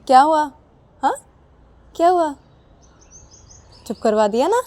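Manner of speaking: put-on wife gloats to husband after calming the baby, smug. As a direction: gloat that she quieted the baby. Sakshi triumphant.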